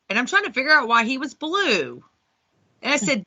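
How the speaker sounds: ordinary delivery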